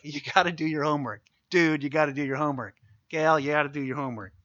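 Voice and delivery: ordinary talk, nothing marked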